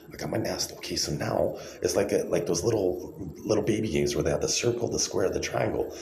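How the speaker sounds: ordinary talk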